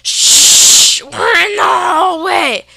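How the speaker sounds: loud shushing yell, high and slow; shushing shout. Retold or imitated. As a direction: hush her loudly from below. Dad shushes back up stairs.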